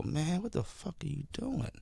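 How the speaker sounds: soft aside to a performer; hushed, retold scolding; muttered disapproval. Retelling own reaction to jokes.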